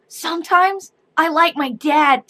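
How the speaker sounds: put-on sweet kid confession; little girl voice. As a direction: say it innocently like a toddler. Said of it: "she goes".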